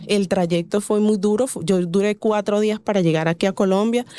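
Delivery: usual speaking voice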